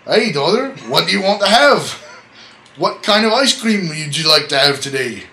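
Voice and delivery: a dad asking in a cartoon Scottish accent; bad Scottish accent. Dad asking his daughter about ice cream, in an over-the-top Scottish accent. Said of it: "That's probably the worst Scottish accent".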